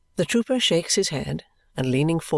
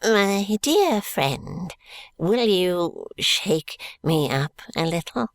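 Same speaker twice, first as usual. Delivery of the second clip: put-on slow, high, creaky old miser; a slow quavering old miser; old man voice; 4 semitones higher, much slower than usual. A narrator's reading. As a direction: croak it like a crafty old miser. Old man asks to be shaken.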